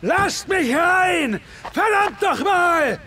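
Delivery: hoarse-throated pounding-on-the-door yells; desperate shout. Acted. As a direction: bellow to be let in, furious and pleading. Jack pounding on hell's gate.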